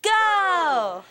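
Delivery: shouted pep-rally cheer. Real, not acted. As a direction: yell it out to the crowd. School cheer call and response.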